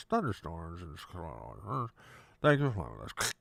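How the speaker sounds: pilot talking with a finger in mouth; garbled cabin intercom pilot; muffled pilot voice. Retold or imitated. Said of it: "I gotta stick my finger in my mouth to do it right".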